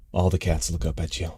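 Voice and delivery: usual speaking voice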